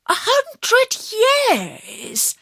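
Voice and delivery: amazed outburst; exclaiming in disbelief. A narrator's reading. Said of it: "I exclaimed".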